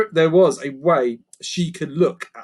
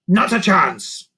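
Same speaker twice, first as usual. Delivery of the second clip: slow, louder goblin refusal; stubborn creaker; 6 semitones higher, 6 dB louder, slower than usual. Acted. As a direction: flatly refuse. Grunt refuses.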